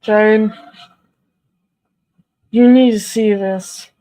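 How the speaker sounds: calling out. Real, not acted. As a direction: call Jane over to look. Calling Jane over.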